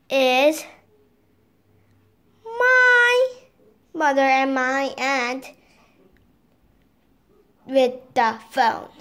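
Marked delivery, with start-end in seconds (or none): none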